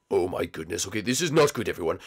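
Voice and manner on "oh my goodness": usual speaking voice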